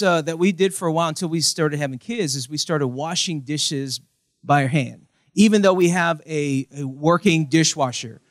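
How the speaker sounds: usual speaking voice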